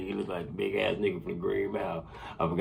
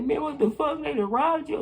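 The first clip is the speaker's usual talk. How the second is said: much higher, astonished outburst; shocked disbelief. Retold or imitated. Cousin hearing his friend got robbed, shocked and high. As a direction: hit it like you can't believe it.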